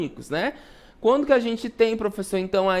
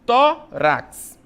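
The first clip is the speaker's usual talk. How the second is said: "tórax" broken into tó, rax with a gap; teacher's two-beat "tórax", tó then rax; split into syllables; 5 semitones higher, 5 dB louder than usual. Shown as a demonstration. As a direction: separate "tórax" into two loud, high syllables.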